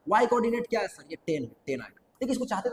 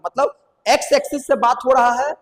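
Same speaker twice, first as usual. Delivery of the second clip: normal talking voice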